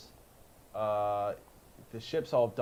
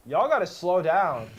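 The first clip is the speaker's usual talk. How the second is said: mock warning call; 3 semitones higher, 9 dB louder than usual. Acts ground control warning.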